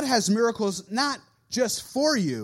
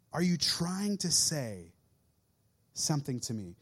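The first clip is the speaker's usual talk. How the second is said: softer, lower sincere prayer; hushed prayer; 6 semitones lower, 5 dB quieter than usual. Pretend. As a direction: pray quietly, really listening. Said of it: "Tune in".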